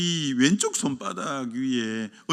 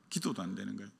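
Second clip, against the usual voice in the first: quiet echo; 6 semitones lower, 11 dB quieter, much faster than usual. Acted. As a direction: drop to a near-murmur on '기도도 안 되는 거야'. Echoed quietly after line 7. (조용히 되뇌듯)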